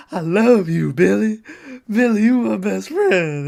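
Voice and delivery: loud, high hammed-up wail; mock crying. Imitating a friend who found his pal dead, mock crying. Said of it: "He's, like, crying".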